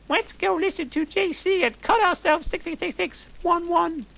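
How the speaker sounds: very high squeaky put-on girl voice; Hermione voice. Hermione inviting friends out, in a very high squeaky voice. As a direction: squeak it out like a cartoon teen. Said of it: "said Hermione".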